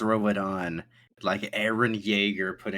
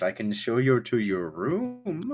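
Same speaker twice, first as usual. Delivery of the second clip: slow, higher host's voice; Thatch; 5 semitones higher, 3 dB louder, slower than usual. Acted. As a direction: draw out the offer, rising in pitch. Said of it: "Thatch goes".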